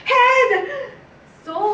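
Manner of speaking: high, very loud single scream; screaming awake. Acted. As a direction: jolt awake with a piercing yell. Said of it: "I wake up screaming".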